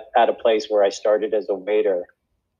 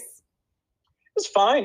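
coy understatement; 11 semitones higher, much slower than usual. Real, not acted. Coy answer about the stars' rehearsal.

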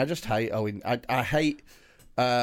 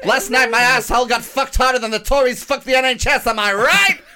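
high, loud sung mock rant; Owen Jones impression; 10 semitones higher, 9 dB louder than usual. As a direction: belt it out like a preachy pundit. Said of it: "Such a good Owen Jones impression".

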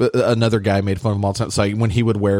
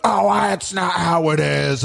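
put-on old coworker voice; 7 semitones higher, slower than usual. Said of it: "he was like".

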